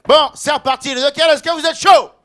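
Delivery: loud emcee shout to the crowd; hype shout. Real, not acted. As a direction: pump up the audience. Host hyping up the crowd.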